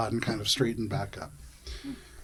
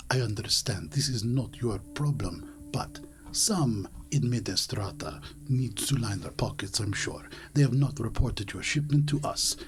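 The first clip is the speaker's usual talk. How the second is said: leaning in, softer, partly whispered; hushed confidential; 3 semitones lower, 6 dB quieter than usual. Acted. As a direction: let the player in on a secret. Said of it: "They lean into you".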